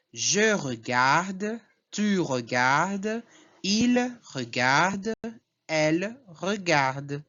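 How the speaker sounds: slow chanted list, every regarde ending alike on D; measured conjugation drill, regardes sounding just like regarde; identical verb endings. Shown as a demonstration. Teacher drills students on same pronunciation, slow even regarde in each person. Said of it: "é a mesma pronúncia".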